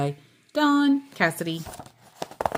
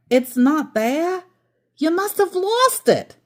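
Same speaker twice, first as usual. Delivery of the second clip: much higher, accusing whine; complaining; 11 semitones higher, 4 dB louder than usual. Acted. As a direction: blame grandpa for losing it. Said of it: "Aunt Miriam complained".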